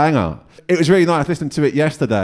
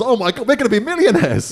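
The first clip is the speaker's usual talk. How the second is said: mock excitement; 9 semitones higher than usual. Mock excited reaction to a cover.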